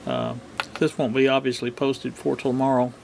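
ordinary talk

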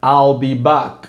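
slow, low mock Terminator line; a hint of the Terminator, drawn out; imitating Arnold. Teacher voices Schwarzenegger's line for shadowing, low and slow. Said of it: "onde ele fala".

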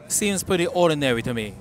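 ordinary talk, nothing marked

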